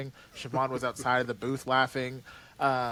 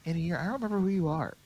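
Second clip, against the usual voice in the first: quoting a character; 5 semitones higher, much faster than usual. Retold or imitated. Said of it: "My favorite line of Dante's is just like".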